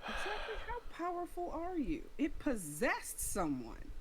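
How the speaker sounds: high, astonished questioning; incredulous. Acted. Player questioning the woman unharmed by the eyes, incredulous.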